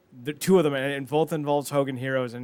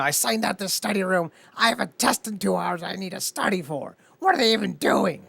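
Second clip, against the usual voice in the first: Garrett; 6 semitones higher than usual. Retold or imitated. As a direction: imitate an indignant student, pitched up. Acting out Garrett's complaint.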